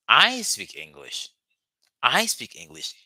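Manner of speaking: I, not him, speak English; stress on I. Shown as a demonstration. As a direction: hit "I" hard and slow. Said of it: "lay emphasis on something".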